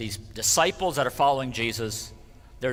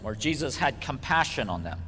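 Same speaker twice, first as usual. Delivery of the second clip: normal talking voice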